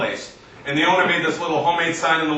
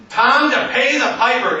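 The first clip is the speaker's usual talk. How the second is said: higher, mock-dramatic thief; phone thief's catchphrase; 8 semitones higher, 3 dB louder than usual. Thief signing off to his victim, mock-dramatic. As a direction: deliver a cheesy villain sign-off.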